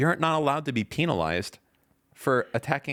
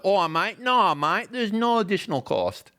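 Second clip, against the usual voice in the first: put-on Australian accent; 7 semitones higher than usual. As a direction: do your best Aussie bloke. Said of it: "It's my best Australian accent".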